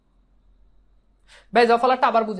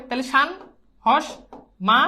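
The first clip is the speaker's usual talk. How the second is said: ordinary talk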